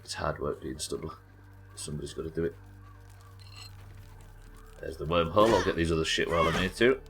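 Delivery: normal talking voice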